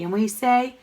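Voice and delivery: ordinary talk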